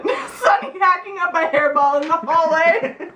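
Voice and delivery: high, laughing delivery; laughing punchline. Real, not acted. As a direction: land the joke through a laugh.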